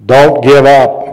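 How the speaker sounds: loud, drawn-out exhortation; big, slow preacher voice; booming voice. Real, not acted. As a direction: boom it out slowly and loudly. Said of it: "You hear that voice?".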